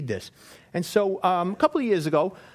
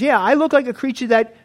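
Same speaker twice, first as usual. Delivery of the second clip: loud, higher proud explanation; a hint of a smug creature; lightly voiced fish-man; mock alien; 4 semitones higher, 9 dB louder than usual. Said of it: "he says".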